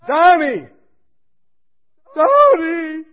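high, loud tearful calling of his name; crying mother. Retold or imitated. A weeping mother on the phone, calling her son through tears. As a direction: cry out to your son. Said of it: "She's crying".

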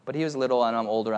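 usual speaking voice

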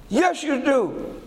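high, slow insistent reply; mock-angry wife insisting; stubborn insistence. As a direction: drag it out, pitched high. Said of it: "That lady was livid".

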